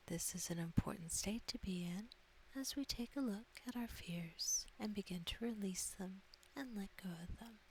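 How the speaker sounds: ordinary talk, nothing marked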